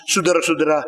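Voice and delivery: normal talking voice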